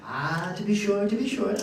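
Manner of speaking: lilting Irish granny imitation; thick Irish accent. Irish grandmother reassures doubting grandchild, thick Irish accent. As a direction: answer knowingly in an Irish accent. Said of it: "with her thick Irish accent".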